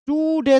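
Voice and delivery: "टू डे" with a long, high टू; wrongly stressed TOO. Shown as a demonstration. Teacher warns students against this reading, stretched stressed टू in "टू डे". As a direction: pull out the first syllable of "टू डे" as its own word. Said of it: "तो नहीं पढ़ना".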